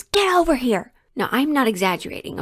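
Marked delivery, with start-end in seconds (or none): 0.0-0.9 high-pitched barked order imitating a boss; retold scream. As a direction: yell it like a boss summoning someone. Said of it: "And then he screams".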